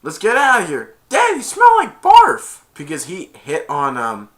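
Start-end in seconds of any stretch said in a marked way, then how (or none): 0.0-1.0 high, loud urging; calling out. Retold or imitated. Acting neighbor calling out. 1.1-2.6 very high, loud, slow whine; pitched-up kid voice; kid's complaint. Retold or imitated. Acting kid's disgusted complaint.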